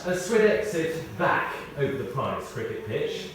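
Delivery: ordinary talk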